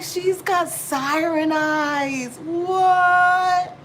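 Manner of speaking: high, loud, very slow marvel; awestruck gush. Retold or imitated. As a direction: stretch it out, amazed. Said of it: "I was like".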